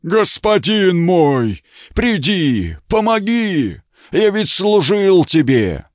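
high, slow desperate monster wail; wounded giant cry. Acted. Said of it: "вскричал голосом раненного великана".

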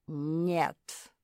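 lightly voiced bass; a curt refusal in a hint of bass. A narrator's reading. Malum refusing his captives, bass voice. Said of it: "ответил знакомый бас".

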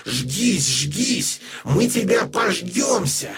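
put-on possessed girl snarling curses; bestial roar. A narrator's reading. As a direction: spit hate like a demon. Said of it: "не крик даже, а рёв звериный".

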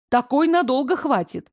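plain reading voice

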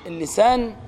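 ordinary delivery

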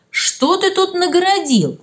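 mildly acted dismay, high-pitched and drawn out; exclaiming. A narrator's reading. Teacher reading Matvey's muddled test, exclaiming. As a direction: exclaim it slowly, pitched well up. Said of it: "Антонина Васильевна воскликнула".

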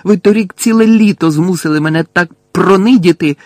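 heated, forceful protest; furious retort. A narrator's reading. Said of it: "розлючено обернувся до нього Гаррі".

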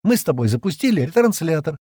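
high, loud, pointed argument; sharp challenge. A narrator's reading. Джемма turning on Стэн over the rescue ship, sharply. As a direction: argue it sharply, ending on a challenging question. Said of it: "резко спросила Джемма".

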